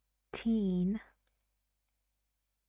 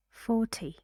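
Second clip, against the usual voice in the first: Forty with the weight on the first syllable, for; Forty, for stressed, ty light; 2 semitones higher than usual. Shown as a demonstration. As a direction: hit for in Forty, then a short ty. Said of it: "see the difference".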